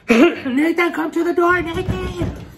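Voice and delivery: high, loud call through the door. Retold or imitated. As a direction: call his name from the hallway. Calling out to him outside.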